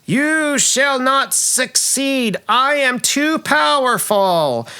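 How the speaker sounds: high, drawn-out snake-queen proclamation; booming stage villain. Acted. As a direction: stretch each word like a hammy villain actress. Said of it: "snake kind of multi-headed person says".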